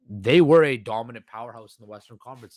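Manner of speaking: normal talking voice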